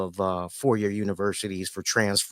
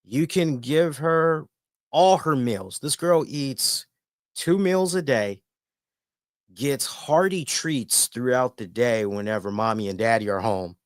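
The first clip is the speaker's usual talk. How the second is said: ordinary talk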